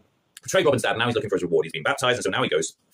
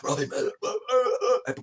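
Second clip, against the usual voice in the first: high, feeble deathbed moans; hammed-up groaning between words; mock dying; 11 semitones higher, 3 dB quieter than usual. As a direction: weakly call out, voice rising. Acting dying mother again.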